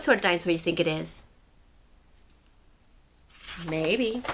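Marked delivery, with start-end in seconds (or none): none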